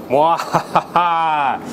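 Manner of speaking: slow evil cackle; mad scientist laugh. Pretend. Mad laugh in soundproof room.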